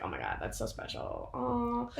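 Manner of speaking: soft, hushed mock tears; touched, teary. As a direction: melt with emotion. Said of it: "they're like".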